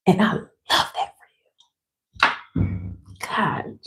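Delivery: breaking into a whispered cry, voice gone; tears choking the words down to a whisper; tearful whisper. Real, not acted. Thanking her loyal members, overcome and crying in a whisper.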